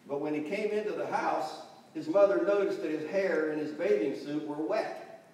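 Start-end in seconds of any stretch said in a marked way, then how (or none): none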